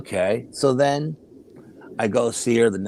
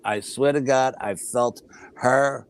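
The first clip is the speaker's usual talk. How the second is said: slow, heavily spaced words; solemn testimony; much slower than usual. Real, not acted. Child swearing they felt Mom's soul leave, slow and emphatic. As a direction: draw out each word with conviction.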